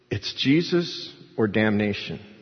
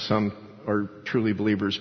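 ordinary talk, nothing marked

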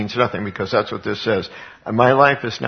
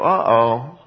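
mock uh-oh. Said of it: "And I go".

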